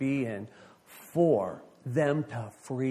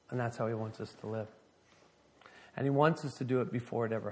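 soft sermon close; 3 semitones lower, 6 dB quieter than usual. Real, not acted.

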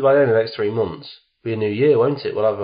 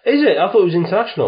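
surprised; 8 semitones higher, 5 dB louder, faster than usual. Real, not acted. Surprised at being corrected.

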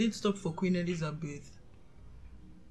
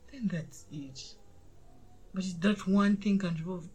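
ordinary delivery

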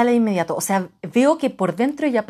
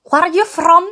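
put-on "Where are you from?" high and loud in an Israeli accent; first go at the stranger's Israeli accent; Israeli-accented English; 8 semitones higher, 7 dB louder, slower than usual. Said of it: "No, no, así, a ver, con la J". ("Where are you from?" con acento israelí, alto y fuerte)

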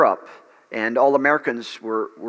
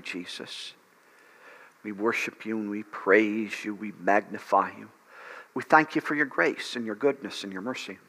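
softer, devoted prayer to Jesus; worship prayer; 3 semitones lower, 5 dB quieter than usual. Real, not acted. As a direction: pray softly, lingering on each thanks. Leads worship prayer after sermon.

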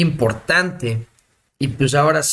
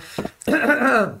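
throat clearing; 8 semitones higher than usual. Real, not acted. Coughing while pausing to drink.

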